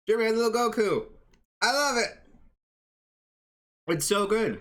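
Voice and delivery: high, loud and rushed gushing; excited. Real, not acted. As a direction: burst out with delight. Excited about Goku drawing.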